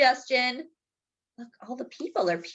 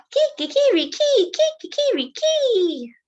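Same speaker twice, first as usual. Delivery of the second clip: high, loud rooster crow; sung-out cock-a-doodle call; 14 semitones higher, 4 dB louder than usual. Acted. Rooster crows in darkness.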